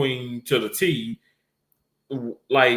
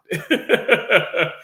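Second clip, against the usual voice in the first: a trailing amused laugh; real laugh; 4 semitones higher, 3 dB louder than usual. Real, not acted. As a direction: let the laugh roll out. Laughs at own story.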